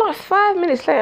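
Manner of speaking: ordinary talk, nothing marked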